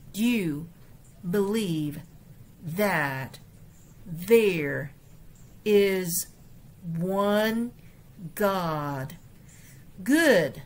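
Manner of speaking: 逐字朗读; word by word. Shown as a demonstration. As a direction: read one word at a time, pausing between. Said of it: "每个字来读过一遍".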